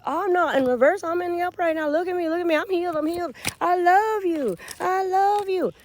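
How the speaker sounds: high, loud put-on begging; imitated pleading suitor. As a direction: mimic a man frantically protesting he has changed. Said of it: "Now he said".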